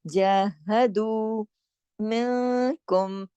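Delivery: जहदू with the दू drawn long; very slow Quranic reading of जहदू मिनकुम; elongated recitation. Shown as a demonstration. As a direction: stretch the दू of जहदू fully. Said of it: "पूरा खींच कर पढ़ के".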